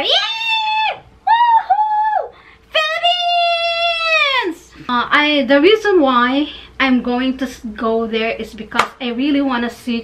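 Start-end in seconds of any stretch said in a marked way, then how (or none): 0.0-4.7 very high, drawn-out squealing cheer; mock scream from the pageant audience; screaming fan. Fan imagines herself screaming there, shrieked cheer. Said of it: "I can see myself screaming there".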